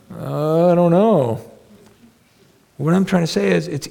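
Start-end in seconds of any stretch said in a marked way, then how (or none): 0.1-1.4 sheepish mom. Retold or imitated. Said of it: "So why do you romanticize it?".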